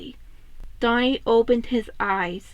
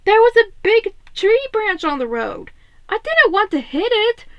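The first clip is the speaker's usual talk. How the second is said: louder, higher tense explanation; raised Raph voice; 10 semitones higher, 6 dB louder than usual. Acted. Raph explaining the swerve to Leo, raised and tense. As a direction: explain loudly over the storm. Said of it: "Raph said".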